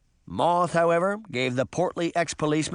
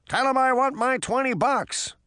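high, insistent call; pitched-up holler; crying out; 11 semitones higher than usual. A narrator's reading. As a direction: shout a demand after someone leaving. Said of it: "Mister Two cried".

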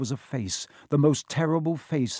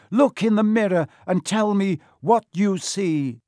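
loud, raised, forceful order; commanding; 7 semitones higher, 6 dB louder than usual. A narrator's reading. Quirrell orders Harry to the mirror, commanding. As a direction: demand an answer at high volume.

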